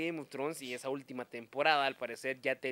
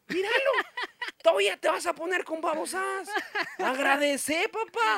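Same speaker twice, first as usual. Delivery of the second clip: laughing mock scolding; 12 semitones higher than usual. Mocking the protagonist, laughing.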